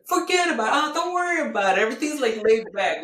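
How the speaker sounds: loud, high mock Chicago accent. Teasing husband's talk, hammed-up Chicago accent. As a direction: ham up the Chicago brush-off. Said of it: "He's had such a Chicago accent".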